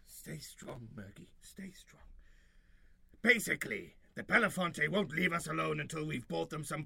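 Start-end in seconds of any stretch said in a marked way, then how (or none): 0.0-2.0 soft whispered self-pep-talk. Acted. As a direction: whisper it to yourself.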